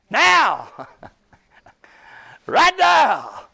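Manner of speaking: loud, high ringing cry of "now"; exclaiming answer. Acted. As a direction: fire the answer at the congregation. Preacher answering his own question.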